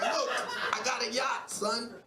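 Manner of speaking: ordinary delivery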